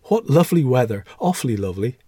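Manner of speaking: lightly acted delight; good-humoured gaiety. A narrator's reading. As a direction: call it out cheerily in passing.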